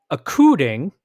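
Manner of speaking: puzzled literal reading, "a-coo-ding" for ACCORDING; misspelled word read out. Retold or imitated. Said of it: "typo, it's supposed to be according".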